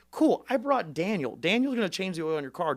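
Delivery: high, loud, upbeat offer; eager pitch. Retold or imitated. Candidate to a voter changing his oil, high and eager. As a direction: cheerfully sweep aside the excuse.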